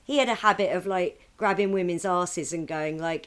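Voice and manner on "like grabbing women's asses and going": ordinary talk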